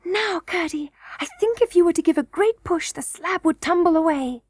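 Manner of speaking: put-on high, hushed girlish excitement; joyous whisper. A narrator's reading. Princess tells Curdie the slab is ready, joyously hushed. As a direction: keep the joy light and high. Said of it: "said in a joyous whisper".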